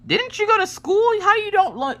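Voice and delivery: put-on very high, squeaky, incredulous child; high mocking kid asking a dumb question; snotty kid voice. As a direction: pitch way up like a smug fifth grader. Kids judging parent's colors.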